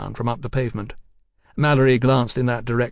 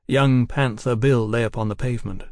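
usual speaking voice

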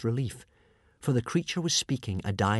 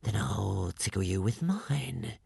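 menacing serpent; 2 semitones lower, 4 dB quieter, slower than usual. Acted. Serpent threatens Alvin.

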